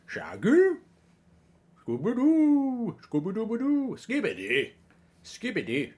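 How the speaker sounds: high cartoon dog voice, swooping up on the word endings; Scooby-Doo. Streamer doing a requested cartoon dog accent for viewers, high Scooby-Doo impression. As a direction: put on a talking cartoon dog, with long high vowels. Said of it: "Do the Scooby-Doo accent".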